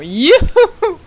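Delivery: high, loud squeal of joy; real excited yelp; gleeful cheer. Real, not acted. Cheering as the game starts.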